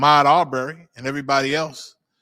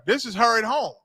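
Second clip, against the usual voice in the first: ordinary talk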